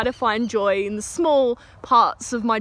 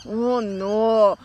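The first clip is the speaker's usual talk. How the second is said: drawn-out, lightly mimicked Indian accent; mock dismay with a hint of Indian accent; bad Indian accent. Manager stares at the kid, in a mock Indian accent. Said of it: "horrible Indian accent".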